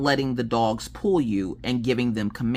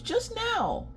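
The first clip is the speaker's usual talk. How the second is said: put-on high, whiny, quieter kid voice; upset child; 5 dB quieter than usual. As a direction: imitate a kid's hurt retort. Upset child accusing the speaker.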